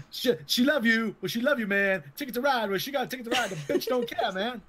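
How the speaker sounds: high, bitter jazzman complaining about stolen songs. Retold or imitated. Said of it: "sounds like the [name] skit".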